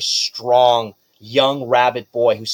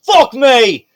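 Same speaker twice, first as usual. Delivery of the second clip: high, loud shout of disbelief; shocked noble; 13 semitones higher, 10 dB louder, much slower than usual. Acted. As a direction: yell it out, stunned the bet is lost. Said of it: "He goes".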